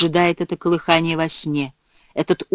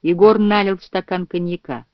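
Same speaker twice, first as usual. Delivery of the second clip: plain reading voice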